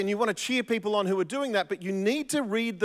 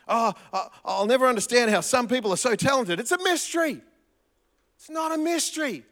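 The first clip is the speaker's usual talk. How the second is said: high mock wonder; 7 semitones higher, 4 dB louder than usual.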